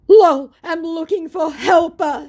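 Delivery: high, loud, slow scammer voice; mock scammer. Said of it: "he says".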